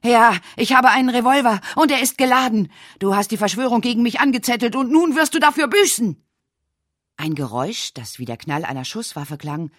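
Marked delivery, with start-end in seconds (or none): 0.0-6.2 high, loud threatening roar; raging shout. Acted. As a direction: shout the threat through the door in fury. Edgar shouting behind closed door.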